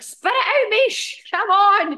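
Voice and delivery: high, loud exasperated nudge; impatient self-scolding. Retold or imitated. Scolding herself while listening back in the car, high and impatient. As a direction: hurry yourself up, fed up. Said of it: "I listen to it in the car and I'm like".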